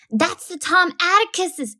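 put-on high, slow urgent child; lightly voiced girl bursting out; blurting. A narrator's reading. Scout to Jem in church, an urgent high blurt.

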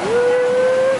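high, loud ooh of wonder; delighted ooh. Real, not acted. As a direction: gasp in delight. Sees the exhibit.

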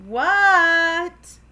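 high, loud, long reply; calling back. Acted. Froggy answers Dad's call, hollering back. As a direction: holler back from another room.

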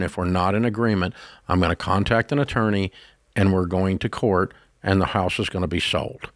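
low, measured, calm ultimatum; calm firmness. Acted. Brother confronting brother over the shared house, very calm and firm. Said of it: "do this very calmly and just say".

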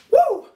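high whoop of hype; excited woo. Real, not acted. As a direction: let out a quick high cheer. End of hyped intro.

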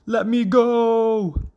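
high, slow, stretched plea; crying out. A narrator's reading. Father frog grabbed by the hawk, high crying out. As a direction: cry it out high and drawn-out. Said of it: "cried father".

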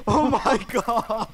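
laughing it out slowly; relieved laughter. Real, not acted. Laughing relief after escape.

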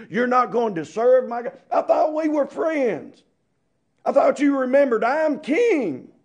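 hammed-up outraged king; angry king. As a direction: play the offended king demanding worship. Preacher voices the angry king.

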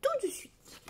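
high, gleeful repeat; gleeful doctor repeating. A narrator's reading. Doctor rubbing his hands, repeating to his patient, high and gleeful. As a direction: savour it while rubbing hands. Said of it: "Il se frotta les mains et répéta".

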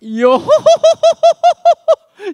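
loud skeleton cackle yohohoho; big hearty skeleton laugh; Brook laugh. Acted.

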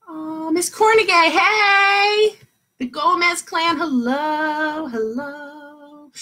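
high, sing-song hellos; delighted greeting. Real, not acted. Teacher spotting families joining the lesson, excited sing-song greeting. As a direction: greet them in a drawn-out, excited lilt.

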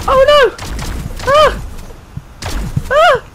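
very high, loud shrieks; real panicked screaming. Real, not acted. Gamer shot at by unseen players, screaming panic. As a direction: shriek the questions in fright.

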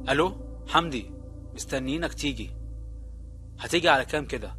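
soft, hushed phone voice; hushed suspense. Acted. Phone call quoted in story. (همس مشوّق خافت)

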